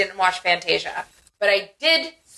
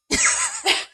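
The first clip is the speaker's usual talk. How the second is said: bursts out in a high giggle; high laugh. Real, not acted. Laughs at falling asleep.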